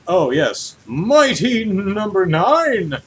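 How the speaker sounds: usual speaking voice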